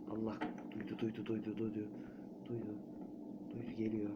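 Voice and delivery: çok kısık, telaşlı fısıltı; korkulu fısıltı. Real, not acted. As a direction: nefesini tutarak fısılda. Nun heard him, hiding. (saklanırken korkuyla fısıltı)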